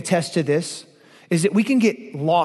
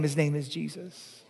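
softly, dropping away on the name; hushed reverent close; 4 semitones lower, 10 dB quieter than usual. Acted. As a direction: hush it to a reverent near-whisper. Quiet drop after shout.

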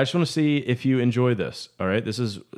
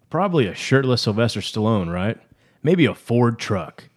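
ad read; 3 semitones higher, 5 dB louder than usual. Acted. Reads a mock sponsor ad.

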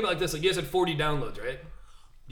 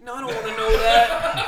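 laughing, slower, higher; laughing protest; 5 semitones higher, 7 dB louder than usual. Real, not acted.

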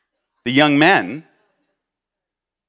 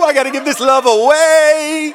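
high, loud, in an altered voice; hammed-up ecstatic convert; mock rapture; 13 semitones higher, 8 dB louder than usual. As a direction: sing it out like you're overflowing. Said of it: "You were like".

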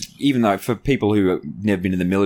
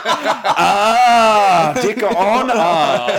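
high laughing, drawn out slowly; cracking up over the name; real laughter, loud and slow; 10 semitones higher, 5 dB louder, much slower than usual. Real, not acted. Laughing at punchline of story.

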